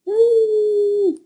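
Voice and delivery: very high, shrill imitation of a fox call; imitated fox scream; fox mating call. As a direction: screech like a fox in the night. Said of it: "That's the noise a fox makes".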